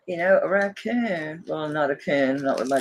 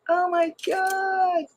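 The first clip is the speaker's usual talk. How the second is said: amazed gasp; 13 semitones higher, much slower than usual. Real, not acted. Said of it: "do you see this thing".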